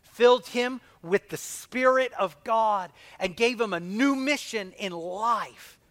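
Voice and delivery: loud, slow preacher's proclamation; rising fervent sermon voice; preaching. Acted. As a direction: proclaim it from the pulpit. Preaching at full force.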